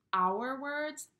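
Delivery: ordinary delivery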